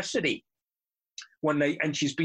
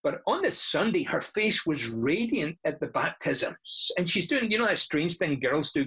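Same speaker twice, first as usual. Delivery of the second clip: ordinary talk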